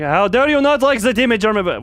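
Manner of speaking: high, loud, hammed-up indignation; mock outrage. Streamer scolding chat for disliking his character, mock indignant. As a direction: scold chat in playful offense.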